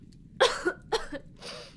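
hacking on smoke; coughing fit. Acted. Sibling chokes on chimney smoke, coughing.

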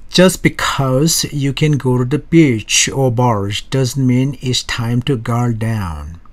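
slow, deliberate English read for a class; whole sentence said slowly as a model. Shown as a demonstration. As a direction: read it again slowly and clearly. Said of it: "다시 한번".

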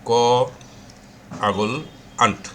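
ক, আগল, আন্ট with long gaps between; split into syllables. Shown as a demonstration. Teacher showing three parts, ক, আগল, আন্ট each said separately. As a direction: pause after each piece: ক... আগল... আন্ট. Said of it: "এখানে কিন্তু তিনটা syllable".

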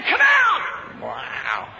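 loud, forceful exorcism cry; commanding shout. Retold or imitated. Preacher repeating the command in Jesus' name, commanding shout. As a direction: shout the demon out.

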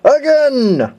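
high delighted shout; excited shout. Real, not acted. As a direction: cry out in delight. Watching fast CPU game.

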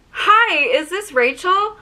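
high, measured greeting; caller's slow, lifted hello; phone caller. Retold or imitated. Retold phone caller greeting her.

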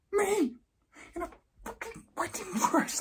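high, squealing disbelief; incredulous outburst. Acted. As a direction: burst out high-pitched, utterly appalled. Outraged at waking in wedding dress.

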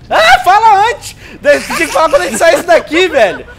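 exasperated shout. Real, not acted. Friend warns too late.

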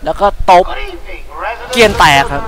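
punchy gamer catchphrase; catchphrase shout. Acted. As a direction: announce the takedown like a hype host. Signature finishing-move catchphrase.

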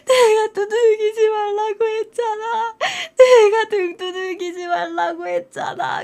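loud, weepy told you not to pat me; sobbing complaint. Retold or imitated. 계속 등 두들기는 친구에게 오열하며 따짐, sobbing. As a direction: wail through tears with a laugh breaking in. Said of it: "막 오열하면서".

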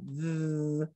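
V buzzed with voice on; voiced V. Shown as a demonstration. Teacher turning F into a voiced V. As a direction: hum the V through the teeth. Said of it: "Say F with your voice box on".

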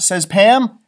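usual speaking voice

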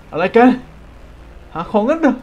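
can't believe it; incredulous outburst. Real, not acted. Reacting to game surprise.

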